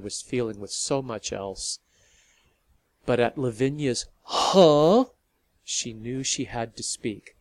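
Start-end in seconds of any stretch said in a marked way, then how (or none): 4.2-5.1 startled huh. Acted. Lavinia's startled reaction.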